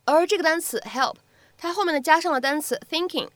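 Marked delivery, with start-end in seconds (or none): none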